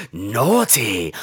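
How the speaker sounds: low, drawn-out British scolding; British accent. Retold or imitated. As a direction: imitate a British narrator savouring the word. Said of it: "the British guy goes".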